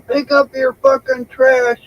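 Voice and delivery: high, slow mock yell; retold yell. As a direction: imitate an angry neighbor, high and drawn out. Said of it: "started yelling at him".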